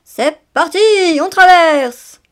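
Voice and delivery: loud call to go, rising to a yell; papa hedgehog yells the start; shouting. A narrator's reading. Papa shouts to the family to cross, loud yell. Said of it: "papa Hérisson cria".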